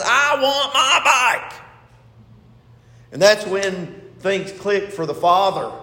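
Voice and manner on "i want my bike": put-on high, drawn-out child's demand; whiny wail of a spoilt boy, put on; mock bratty yell. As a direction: imitate a kid's tantrum demand, stretching each word. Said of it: "he yells out and he's crying".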